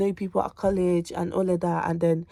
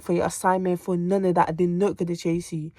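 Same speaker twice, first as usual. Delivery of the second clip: normal talking voice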